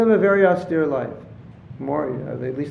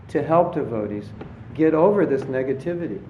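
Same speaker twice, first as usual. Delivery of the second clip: normal talking voice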